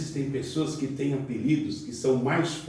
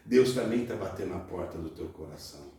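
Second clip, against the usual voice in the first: ordinary talk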